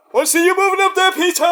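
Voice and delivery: high, quick mock holler; imitated shout. Band calling to Peter before the song, mock shouting. Acting out what they shouted.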